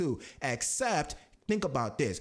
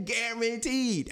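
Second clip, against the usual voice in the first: "Guaranteed" said slowly on a much higher pitch; emphatic guaranteed; 8 semitones higher, much slower than usual. Shown as a demonstration. Stressing to viewers that "Guaranteed" is the key word. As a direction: stretch out "Guaranteed" high to hammer it home. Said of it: "I want to emphasize the word guaranteed".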